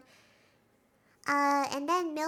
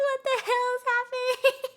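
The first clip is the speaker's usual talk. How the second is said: high, bewildered mock reaction; disoriented confusion; 10 semitones higher, 5 dB louder than usual. Recapper voicing disoriented Mark, high mock bewilderment. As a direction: play baffled in a raised, laughing-edged voice. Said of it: "Mark is, like, disorientated".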